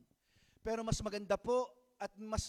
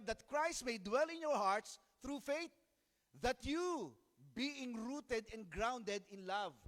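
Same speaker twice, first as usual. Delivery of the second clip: slow scripture reading; much slower than usual. Shown as a demonstration. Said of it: "Ano po ang sabi?".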